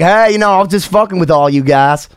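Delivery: folksy put-on Southern accent; mock Southern accent. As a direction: play a Southerner owning up to a prank. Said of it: "start speaking all Southern".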